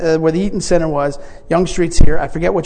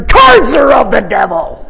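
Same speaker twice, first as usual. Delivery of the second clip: shouted, higher, slow condemnation; mock preacher outrage; 8 semitones higher, 9 dB louder, slower than usual. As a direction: bellow it like a zealot. Preacher answers card invite.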